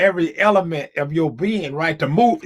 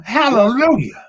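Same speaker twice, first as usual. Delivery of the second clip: exclaimed hallelujah; 8 semitones higher, 3 dB louder, slower than usual. Real, not acted. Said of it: "moment of hallelujah".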